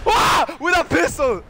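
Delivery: high, loud yell breaking into laughter; delighted screaming laugh; gleeful shouting. Real, not acted. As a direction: crow over the kill, then crack up. Celebrates kill, bursts out laughing.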